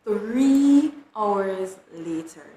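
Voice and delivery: very slow, loud, stretched words; big, slow storyteller announcement; drawn-out time jump. A narrator's reading.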